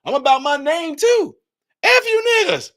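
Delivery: high, loud mocking politician voice; mock mayor. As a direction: play the mayor bragging about money. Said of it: "he like".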